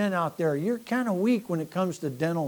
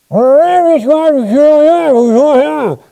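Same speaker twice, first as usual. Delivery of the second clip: much higher, much louder and slow, in a put-on voice; hammed-up hazmat guy; 10 semitones higher, 19 dB louder, slower than usual. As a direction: boom it out like a self-important man in a hazmat suit. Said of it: "and he goes".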